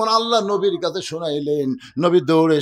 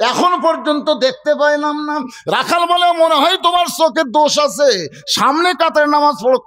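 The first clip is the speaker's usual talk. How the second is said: high, projected dialogue retelling; impassioned preaching; 11 semitones higher, 4 dB louder than usual. Acted. Shepherd telling Osman his eyes are at fault, impassioned. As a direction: push both sides out to the crowd. Preacher voices heated exchange.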